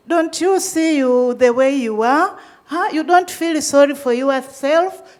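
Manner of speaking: loud, indignant reproach; acted-out incredulous telling-off; retold scolding. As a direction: tell them off, acting the judgmental health worker. Acting out judgmental health workers' scolding.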